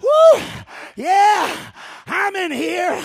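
loud high whoop and reply; excited shout. Real, not acted. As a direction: cheer back with energy. Crowd answers excitedly.

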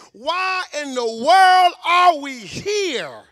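loud, slow, hammering sermon cadence; full-force preaching. Acted. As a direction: preach it out to the back row. Opening peak of preaching.

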